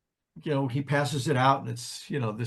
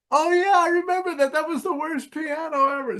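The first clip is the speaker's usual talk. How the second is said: high, louder mock-amused voice of an old pianist; 12 semitones higher, 3 dB louder than usual. Pianist recalling the terrible piano, in a high amused imitation. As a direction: play him tickled by the memory of that awful piano.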